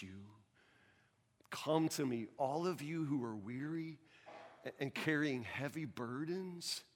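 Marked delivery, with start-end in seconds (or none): 1.5-6.8 slow, soft voice of Jesus inviting; tender scripture. Acted. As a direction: offer rest gently and slowly.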